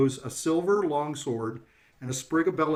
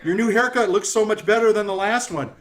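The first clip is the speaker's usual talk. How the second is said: high, loud insult-comic voice; pitched-up showman delivering jibes; brash heckling jokester; 5 semitones higher, 6 dB louder than usual. Acted. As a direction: play a boisterous greeter roasting the guests. Jokester figure roasts a player.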